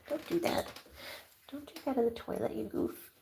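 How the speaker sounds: whispering at the cat drinking from the toilet; whispered scolding. Real, not acted. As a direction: hush the cat gently.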